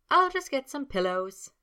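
high, loud and cheery. A narrator's reading. Sabrina offers Snape pillows, high and cheery. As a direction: chirp it brightly. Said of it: "she said cheerily".

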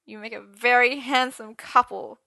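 high, slow, tearful wail; miserable gulping. A narrator's reading. Arthur congratulating Merlin on his lover, miserable wail. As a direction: force congratulations out through misery. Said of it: "he gulped miserably".

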